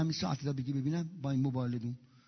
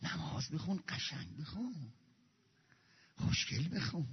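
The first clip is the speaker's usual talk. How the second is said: tender plea; 2 semitones higher, 9 dB quieter than usual. Real, not acted. Tender plea, much softer.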